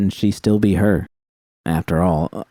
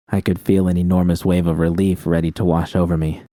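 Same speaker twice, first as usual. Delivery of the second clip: usual speaking voice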